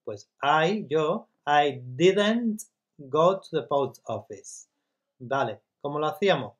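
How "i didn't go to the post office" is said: very slow, every word laid out for learners; slow model sentence. Shown as a demonstration. Teacher modelling the negative for Spanish students, very slow and clear. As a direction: spell it out slowly for the class. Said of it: "acordaros primero, el I".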